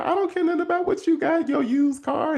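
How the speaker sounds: very high playful joke; light mocking tease; teasing. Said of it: "He was joking on her".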